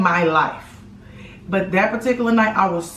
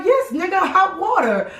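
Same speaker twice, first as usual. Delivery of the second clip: mimicking best friend; 9 semitones higher than usual. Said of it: "and she was like".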